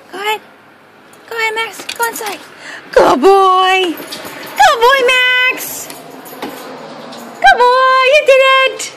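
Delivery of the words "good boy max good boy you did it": excited dog praise. Real, not acted. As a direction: cheer the dog on in a sing-song voice. Praising the dogs after they go inside.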